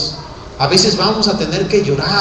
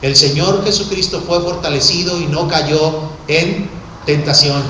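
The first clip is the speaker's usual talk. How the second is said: usual speaking voice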